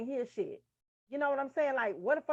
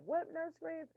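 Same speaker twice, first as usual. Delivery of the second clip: higher but soft, asking to hear it; retold puzzled question; 5 semitones higher, 9 dB quieter than usual. Nurse student can't hear Nurse Griggs, soft puzzled question. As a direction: softly ask what was said, pitch lifted. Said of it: "And I be like".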